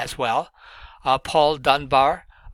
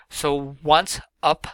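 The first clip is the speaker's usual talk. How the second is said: ONCE hit, up light, slowly; slow stressed demo; slower than usual. Shown as a demonstration. Teacher showing students a metric foot, stressed. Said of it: "is one metric foot".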